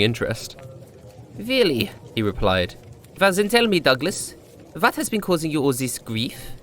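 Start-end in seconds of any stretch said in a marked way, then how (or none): 1.4-1.9 put-on much higher, intrigued old bartender; a higher voiced bartender's intrigued question; curious bartender. A narrator's reading. 3.2-6.5 put-on higher, gently probing old bartender; the bartender drawing out the drunk's woes in a higher voice; curious bartender. A narrator's reading.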